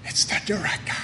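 put-on kids whispering in awe; hushed and afraid, a frightened whisper; scared whisper. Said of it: "And they go".

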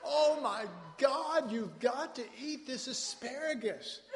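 high, loud, slow gush with a laugh; excited gushing friend. Retold or imitated. A dinner guest urging Randy to eat asparagus, gushing loudly. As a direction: rave about the food, drawn out and laughing. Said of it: "so this guy goes".

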